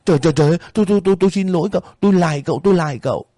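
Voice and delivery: high, fast, stammering plea; landlady begging the boy, high and rushed; panicked begging. Acted. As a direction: grovel in panic.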